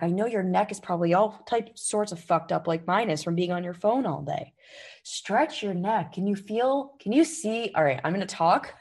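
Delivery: ordinary talk, nothing marked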